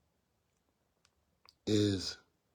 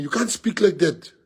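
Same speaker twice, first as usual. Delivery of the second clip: mimicking interviewer; 7 semitones higher, 4 dB louder than usual. Mimics the interviewer scolding him.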